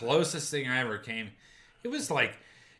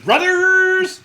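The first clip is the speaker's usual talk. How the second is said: exultant shout; 16 dB louder than usual. Real, not acted. Toasting brotherhood, laughing.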